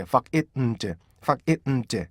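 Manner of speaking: find slowly as 팍-잇-음-드; staccato breakdown of find; detached syllables. Shown as a demonstration. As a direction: break fuck it, 음, 제 into beats. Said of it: "이렇게".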